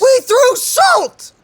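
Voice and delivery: high, loud, slow mock-outraged shout; drawn-out indignant yell; incredulous shout. Real, not acted. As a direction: belt it out in amused disbelief. Player mocks the salt victory.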